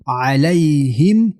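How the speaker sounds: slow علیهم closing on a plainly sounded m; clear m. Shown as a demonstration. Teacher showing izhar in علیهم, slow clear m. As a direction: finish علیهم with lips closed on a distinct m.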